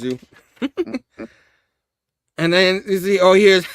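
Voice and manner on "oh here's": usual speaking voice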